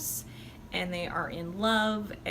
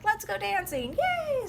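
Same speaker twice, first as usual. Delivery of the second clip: high squealing call to go dance; excited party cheer; 10 semitones higher, 4 dB louder than usual. Retold or imitated. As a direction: rise up into a giddy yay.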